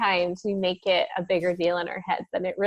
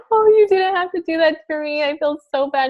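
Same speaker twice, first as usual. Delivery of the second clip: teary gratitude; 8 semitones higher, 5 dB louder than usual. Retold or imitated. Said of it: "I started to cry".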